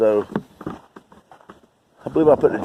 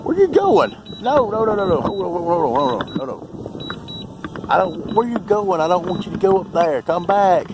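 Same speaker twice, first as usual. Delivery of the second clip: high, anxious calls after a runaway drone; rising alarm as it flies away; panicked calling; 7 semitones higher than usual. Real, not acted. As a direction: plead with the drone to come back.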